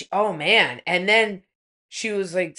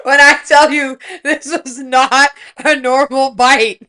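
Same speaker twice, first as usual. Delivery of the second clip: laughing; 3 semitones higher, 7 dB louder than usual. Real, not acted. Laughing emphatic claim.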